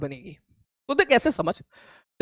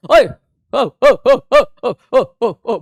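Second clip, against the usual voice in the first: loud, higher ओ cries; loud exclamations; 3 semitones higher, 7 dB louder, much slower than usual. Real, not acted. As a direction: call out to stop him. Exclamations at a mistake. (ज़ोर से ओ ओ पुकारना)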